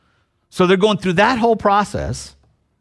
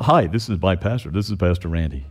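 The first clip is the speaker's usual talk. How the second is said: sheepish embarrassed introduction; 10 semitones lower, 4 dB quieter than usual. Retold or imitated. Churchgoer shyly introducing the pastor at an office party, embarrassed. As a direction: lower and softer, an ashamed mumble. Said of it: "And you do it in that voice".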